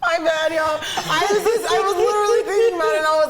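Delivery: real flustered laugh while explaining; laughing embarrassed. Real, not acted. Embarrassed by phone ringing.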